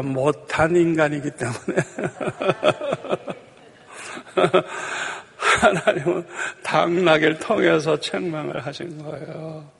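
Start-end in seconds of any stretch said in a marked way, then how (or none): 4.1-5.2 amused chuckle. Real, not acted. Preacher laughs at being worse than a donkey, amused. Laughs at his own humbling joke.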